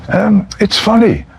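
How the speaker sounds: a lightly voiced suspicious mother-in-law. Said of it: "My mother-in-law said".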